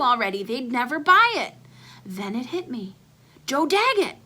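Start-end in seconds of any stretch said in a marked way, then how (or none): 3.5-4.2 high, bright burst of the name; excited realization. Acted. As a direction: blurt the name like a eureka. Sudden idea, named with excitement.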